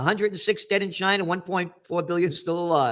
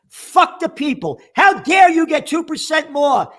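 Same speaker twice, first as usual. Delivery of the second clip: escalating mock outrage; mock outrage shouted back at the bank chief; sarcastic mock outrage, louder and higher; 10 semitones higher, 8 dB louder, slower than usual. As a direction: sneer it louder each time, building up. Mocking the central bank's stance.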